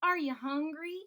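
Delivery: friendly note read out eagerly; cheerful question. Acted. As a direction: ask it like a happy surprise. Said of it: "The note said".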